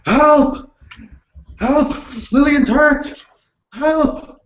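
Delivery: desperate cries for help. Acted. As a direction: call out for anyone to come, panicked. Calling for help over dying girl.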